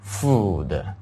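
"food" with a drawn-out oo vowel; slow, long-vowelled "food", pausing between repeats. Shown as a demonstration. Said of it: "它是很长的音".

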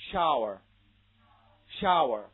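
"Shower" slow and loud with a full wide vowel; vowel demonstration. Shown as a demonstration. Teacher contrasting shower with a similar phrase, slow vowel demonstration. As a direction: draw out the vowel of "shower" clearly. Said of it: "从元音上也有一定的区别".

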